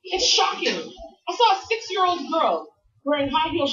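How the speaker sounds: ordinary talk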